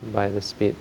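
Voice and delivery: ordinary talk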